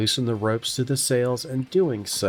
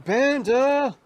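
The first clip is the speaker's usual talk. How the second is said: high, louder call below deck; yelling a name; 12 semitones higher, 4 dB louder than usual. Acted. Captain yelling down for her healer. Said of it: "she yells down".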